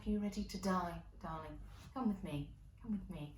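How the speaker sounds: soft, hushed and dreamy like an angel of death; angelic summoning voice. Retold or imitated. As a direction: coax her gently toward heaven. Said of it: "She's like".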